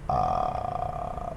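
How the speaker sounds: creaky, low rattling ah; vocal fry. Shown as a demonstration. Coach demonstrating vocal fry. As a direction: let the voice crackle at the bottom. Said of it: "Vocal fry".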